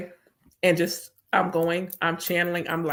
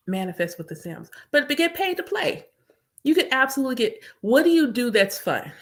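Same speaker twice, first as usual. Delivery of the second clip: ordinary talk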